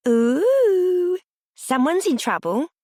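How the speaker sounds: high sing-song taunt; sing-song teasing. A narrator's reading. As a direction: tease it like a playground chant. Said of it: "said in a sing-song voice".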